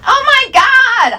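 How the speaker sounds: very high, drawn-out squeal; high-pitched hammed-up freak-out; girls freaking out. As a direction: squeal it way up high like a hysterical girl. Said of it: "Other girls freak out".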